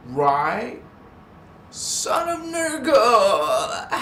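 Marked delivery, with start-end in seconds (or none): 1.7-3.9 high, slow loud shout; shouted hail. Real, not acted. Hailing a viewer by name, loud slow shout. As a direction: bellow the name out.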